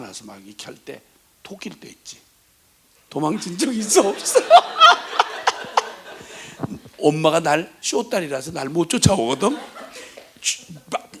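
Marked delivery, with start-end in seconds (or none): 4.4-6.8 squealing laugh, high and loud; laughing at running from mom. Real, not acted. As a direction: burst into a shrill giggle. Laughter at runaway story.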